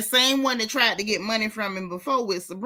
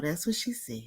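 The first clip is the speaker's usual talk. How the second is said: breathy whispered aside; whispered repeat; 4 semitones lower, 9 dB quieter than usual. Pretend. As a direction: hush it like a secret. Echoes her line softly.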